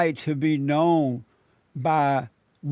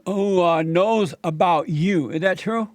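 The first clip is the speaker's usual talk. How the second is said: usual speaking voice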